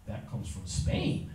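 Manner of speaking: slow hushed whisper; secretive aside. Real, not acted. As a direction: lean in and whisper it. Aside to class.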